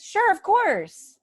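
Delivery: mock over-eager helper, stretched out; gushing people-pleaser yes. Eager helper answering neighbor.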